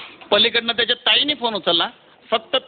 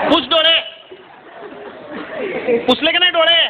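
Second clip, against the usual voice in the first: scolding brother on the phone; 6 semitones higher than usual. Retold or imitated. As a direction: tell her off then calm her. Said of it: "फक्त ताईच म्हणाला".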